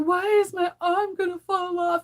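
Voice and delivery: put-on high, whiny little girl voice; worried child asking why her arm will fall off; imitating daughter. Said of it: "And she'll be like".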